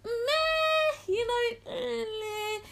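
high, long-drawn meh; exaggerated meh. Shown as a demonstration. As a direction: stretch it out high, unimpressed. Acts out indifference for C tier.

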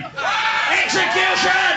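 high, drawn-out cry for punishment; stretched, high-pitched demand; mock-outraged shout. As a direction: holler the verdict like a rabble-rouser.

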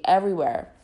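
ordinary talk

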